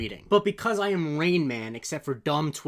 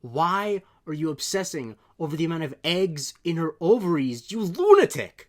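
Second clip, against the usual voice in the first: slow, building to a loud, higher outraged yell; incredulous fury rising to a shout at the end; exasperated rant; 4 semitones higher, 6 dB louder than usual. Real, not acted. Outraged rant about creepy tweet.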